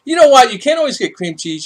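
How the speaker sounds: sudden high, loud exclamation; exclaiming. Real, not acted. Vlogger to viewers, a sudden high loud exclamation. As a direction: burst out with the thought. Sudden outburst about scarce ingredients.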